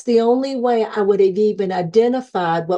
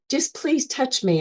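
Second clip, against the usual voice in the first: higher, softly voiced request; soft plea; 4 semitones higher, 6 dB quieter than usual. Nurse in elevator begging patient for a touch, pleading. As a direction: plead gently, lightly acted.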